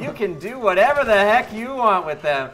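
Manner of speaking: high, loud shopping-channel hype; QVC host. Acted. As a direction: push the big finish like a TV salesman. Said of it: "doing a Q V C show for shovels".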